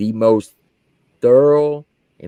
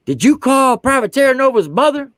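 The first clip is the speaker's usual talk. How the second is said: colonel's demand; 12 semitones higher, 4 dB louder than usual. Retold or imitated. Colonel interrogating him.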